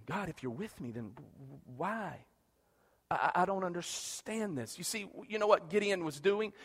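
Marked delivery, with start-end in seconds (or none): 0.1-4.8 quiet complaint. Retold or imitated. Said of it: "That's what Gideon was saying".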